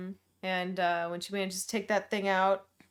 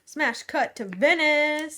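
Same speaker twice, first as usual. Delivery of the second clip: dramatic announcer; 8 semitones higher, 8 dB louder, much slower than usual. Pretend. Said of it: "announces scene cut".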